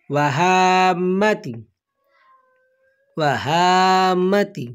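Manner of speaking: আউযু বি কালিমাতিল্লাহি তাম্মাতি drawn out slowly, word by word; slow Arabic recitation. Shown as a demonstration. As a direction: recite each word slowly so learners can repeat it. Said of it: "তো উচ্চারণটি হবে". (ধীরে ধীরে ভেঙে ভেঙে তিলাওয়াত)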